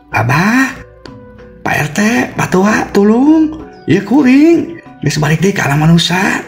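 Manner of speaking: very high ghostly woman's call; Ambu Edo. Acted. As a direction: cry for help in a piercing, high-pitched voice. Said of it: "kadenge deui suara Ambu Edo".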